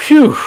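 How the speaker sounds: loud, high-pitched exhaled whew; stunned whew. Real, not acted. Streamer absorbing sad news, heavy stunned whew. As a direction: blow out the shock in one breath.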